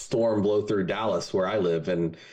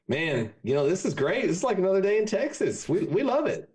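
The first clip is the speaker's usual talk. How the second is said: higher, easygoing and unbothered; Texas good ol' boy; 9 semitones higher than usual. Acted. Texas boys telling the Devil hell feels like home, higher and carefree. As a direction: shrug it off like it's a normal day. Joke, Texans answer Devil.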